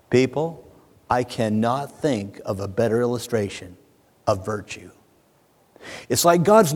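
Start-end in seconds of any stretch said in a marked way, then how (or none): none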